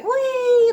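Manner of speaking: drawn-out sliding squeal; high-pitched "wee". Retold or imitated. Imitating herself sliding across floor.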